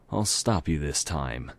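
usual speaking voice

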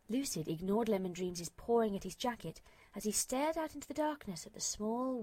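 plain reading voice

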